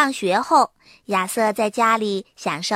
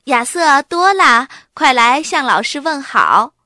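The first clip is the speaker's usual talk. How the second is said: louder call across the house; 4 semitones higher, 8 dB louder than usual. A narrator's reading. Mom calling the kids to greet the teacher, loud call. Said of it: "妈妈喊".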